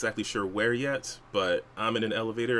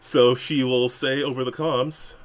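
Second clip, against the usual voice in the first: ordinary talk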